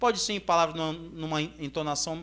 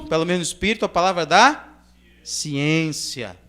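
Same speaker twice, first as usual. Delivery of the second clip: normal talking voice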